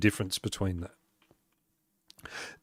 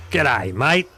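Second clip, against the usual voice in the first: exaggerated Aussie greeting accent; broad Australian accent. Shown as a demonstration. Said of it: "It's not that accent".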